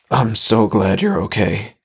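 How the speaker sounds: slightly strained reassurance; mildly strained, as if held too tight. A narrator's reading. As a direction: let a touch of strain into the voice. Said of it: "a slight strain in his voice this time".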